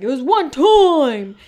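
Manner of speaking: high, loud, drawn-out mock outburst; mock protest. Imitating Tom defending himself to everyone, mock loud protest. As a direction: wail the excuse, imitating someone defensive. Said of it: "And then Tom is like".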